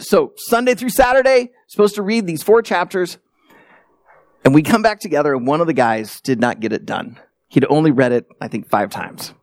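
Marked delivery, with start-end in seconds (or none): none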